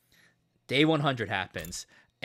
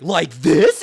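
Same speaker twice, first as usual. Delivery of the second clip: loud innocent question; 3 semitones higher, 12 dB louder than usual. Retold or imitated. Gaku pressing the device.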